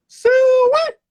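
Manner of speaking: very high, loud hog holler; country hog call. Acted. Country boy caps his speech, piercing hog holler. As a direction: holler it like calling in hogs.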